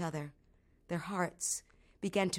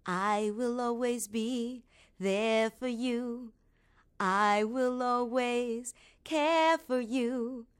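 very slow, tender, drawn-out reassurance; a spirit's lullaby carried on the breeze; ghostly mother on the wind; 4 semitones higher, much slower than usual. Acted. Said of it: "her mother's voice swirling around her in the wind".

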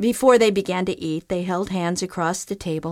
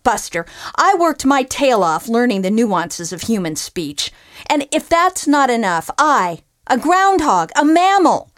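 higher, louder tirade; ranting groundhog; 5 semitones higher, 6 dB louder than usual. Acted. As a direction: tear into the ungrateful bum. Groundhog rants at fisherman.

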